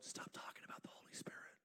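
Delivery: whispered mock sulk; the Father pretending jealousy, whispered; jealous whisper. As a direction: hiss a petty jealous complaint softly. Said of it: "The Father's not in heaven going".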